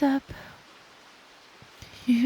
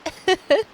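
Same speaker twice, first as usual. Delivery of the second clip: giggle; 8 semitones higher, 5 dB louder than usual. Real, not acted. Laughs after scolding.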